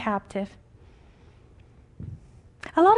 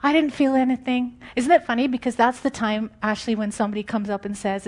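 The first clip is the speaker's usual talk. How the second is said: ordinary talk, nothing marked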